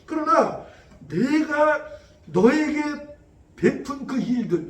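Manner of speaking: slow solemn divine emphasis; emphatic God. Acted. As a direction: lay down God's words one by one with weight. Preacher voicing God.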